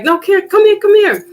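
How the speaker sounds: high, eager calling someone over; imitating the sister's high excited call. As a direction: call him over, bright and keen. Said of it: "And she's like".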